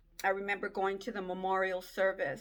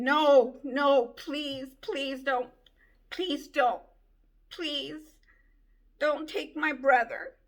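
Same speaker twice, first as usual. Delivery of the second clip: pitched up, loud pleading cry; screaming plea; 5 semitones higher, 6 dB louder, slower than usual. Retold or imitated. At the graveside begging not to bury her brother, loud crying plea. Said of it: "screaming and crying and saying".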